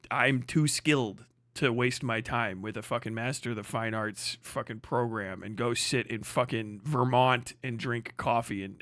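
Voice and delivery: ordinary talk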